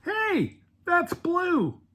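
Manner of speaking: very high-pitched mock amazement; high voice of sudden realization; imitated surprised sister. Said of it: "until she went".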